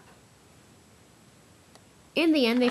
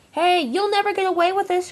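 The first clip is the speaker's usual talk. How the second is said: high, loud defiant cry; 9 semitones higher, 6 dB louder than usual. Acted. Spam Can crying out at Diesel while swept away. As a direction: shout your threat after him. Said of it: "cried Spam Can".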